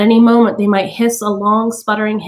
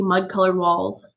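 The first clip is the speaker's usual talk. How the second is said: usual speaking voice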